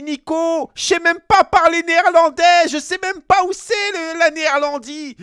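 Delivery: high, loud, whiny panicked protest. Retold or imitated. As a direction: squeal it out as a scared kid. Teen protesting to Nico about Dutch. (protestation paniquée, aiguë et forte)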